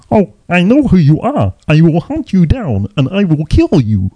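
high puppet-frog voice making a threat; Kermit the Frog. Acted. Dad teasing his kids with Taken speech, high froggy voice. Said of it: "trying to talk like Kermit".

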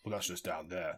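put-on generic American accent. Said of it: "his gladiator accent, which is like".

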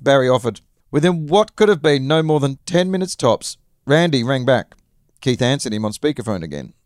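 ordinary delivery, nothing marked

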